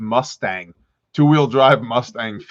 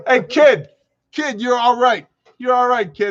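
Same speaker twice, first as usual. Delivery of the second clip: mock mobster; 9 semitones higher, 3 dB louder than usual. Mobster repeats the praise.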